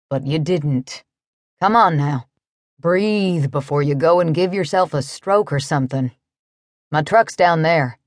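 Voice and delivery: easygoing cowboy. A narrator's reading. Narrator voices the cowboy.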